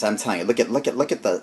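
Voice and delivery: ordinary talk, nothing marked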